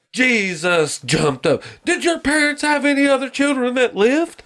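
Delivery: high-pitched drill sergeant tirade; Arlie Erber. Acted. Favorite Full Metal Jacket part, sergeant impression. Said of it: "where fucking Arlie Erber looks like".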